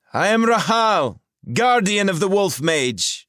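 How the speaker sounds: high, loud commanding woman's voice; a sorceress demanding answers, loud and clear. A narrator's reading. Rahal challenges the intruders, high and commanding.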